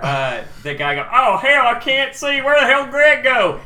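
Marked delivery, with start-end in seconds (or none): 1.1-3.6 high, loud blind confusion; hammed-up bewildered yelling; panicked shout. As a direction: holler lost in the fog. Guard blinded by fog.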